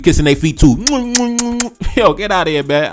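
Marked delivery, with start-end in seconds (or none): 0.8-1.9 high-pitched cackling laugh; incredulous laugh; burst of laughter. Real, not acted. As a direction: let out a sudden high laugh. Laughing at the hypocrisy.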